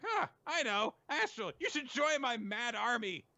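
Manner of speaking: very high cartoon character voice; imitated schemer. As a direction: squeak out a bright idea in character. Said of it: "so he's like".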